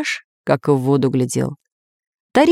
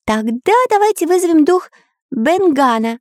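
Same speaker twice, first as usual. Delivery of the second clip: high-pitched cartoon hero voice; keen artist character; 11 semitones higher than usual. Artist reading to his friends, high keen voice. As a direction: puzzle it out in a high, lively voice. Voices Karandash proposing a plan.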